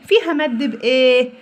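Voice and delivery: ordinary delivery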